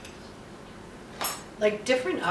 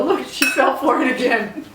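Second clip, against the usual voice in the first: mom's voice; 5 semitones higher, 8 dB louder than usual. Retold or imitated. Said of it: "she'd turn around and go".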